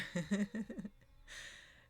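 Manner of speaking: amused, easy laughter. Real, not acted. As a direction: giggle trailing on after the line. Amused at meditation party line.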